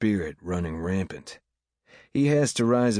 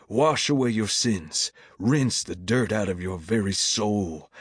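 raised, cadenced preacher's pleading; fervent altar call; 3 semitones higher than usual. A narrator's reading. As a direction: plead with the congregation to come forward.

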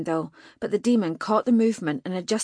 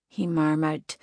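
plain reading voice

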